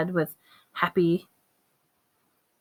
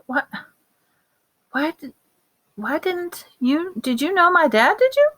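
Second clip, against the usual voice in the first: high, rising disbelief; astonished; 10 semitones higher than usual. Acted. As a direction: ask in shocked disbelief. Harry stunned Lupin knew dad.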